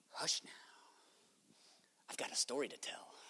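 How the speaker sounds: very soft acted whisper; hushed whisper. Peter to the astonished group before his story, whispering. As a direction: whisper it low to hush the listeners.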